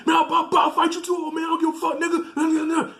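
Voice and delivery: stammering hammed-up shout, far above normal pitch; boyfriend yelling back. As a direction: act out a defiant challenge in a frantic high voice. Acts boyfriend yelling back at dad.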